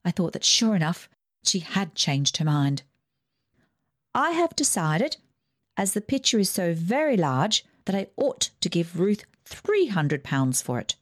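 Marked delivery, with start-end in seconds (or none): 4.1-11.0 put-on higher, imperious old aunt; Aunt Ida's voice. Rich aunt dismissing the family, higher and bossy. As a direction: lift the pitch and command like a rich aunt.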